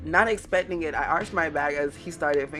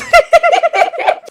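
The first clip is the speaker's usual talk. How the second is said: shrieking laugh; 17 dB louder than usual. Real, not acted.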